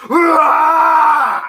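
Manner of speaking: full-throated, high screaming roar; long, loud animal roar at full force; Wolverine. Retold or imitated. Comic acting like Wolverine in the hospital, screaming roar. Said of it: "I was out of my fucking mind".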